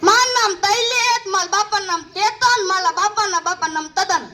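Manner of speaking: put-on તૈલેત, ચેતન and તદન said with a childish lisp, t for sh; lisping names. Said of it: "તોતલું ના બોલતો".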